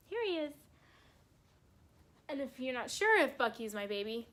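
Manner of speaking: usual speaking voice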